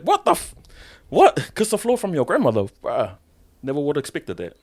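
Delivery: high-pitched, stunned what-the-hell; shocked disbelief. Retold or imitated. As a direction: act out friends hearing something unbelievable. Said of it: "they're like".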